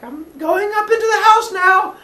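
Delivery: loud high call to friends. Retold or imitated. Calling out from below.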